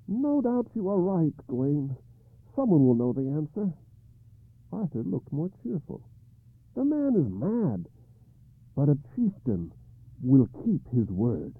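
King Arthur, troubled, telling his knight the terms; a lightly voiced anxious king; Arthur's worried voice. Arthur answers Gawain.

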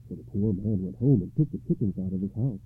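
usual speaking voice

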